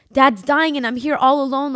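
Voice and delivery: loud, high desperate cry; retold panic at her dying dad; panicked cry. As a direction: cry out for help, all alone. Retold panic alone at deathbed.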